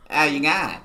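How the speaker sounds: a playful stab at the Aussie greeting; mock Aussie accent. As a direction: friend trying out the Aussie How you going?. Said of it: "tripping me out".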